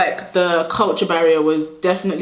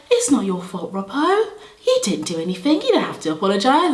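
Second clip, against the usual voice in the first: imitated puzzled reassurance; 3 semitones higher than usual. Puzzled classmates replying, acted.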